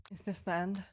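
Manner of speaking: ordinary delivery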